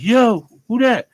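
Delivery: loud, slow call through a closed door; wary shout through the door. Retold or imitated. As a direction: yell it at whoever's outside.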